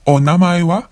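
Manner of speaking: Onamae wa with the pitch rising at the end; rising intonation on wa; rising question. Shown as a demonstration. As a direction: lift the wa into a question. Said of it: "with a rising intonation".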